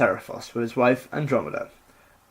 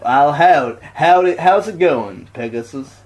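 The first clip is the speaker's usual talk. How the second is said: put-on cowboy accent; 4 dB louder, slower than usual. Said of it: "Perseus speaks like a cowboy".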